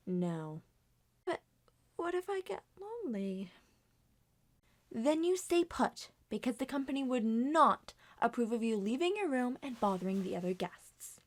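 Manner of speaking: ordinary talk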